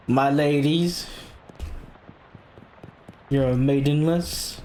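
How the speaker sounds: high, drawn-out mock gallantry; mock fedora gentleman. Player posing as a fedora guy for the ladies, mock gallant.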